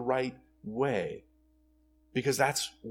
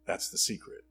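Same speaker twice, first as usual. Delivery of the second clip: ordinary talk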